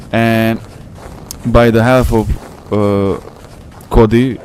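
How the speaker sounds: ordinary talk, nothing marked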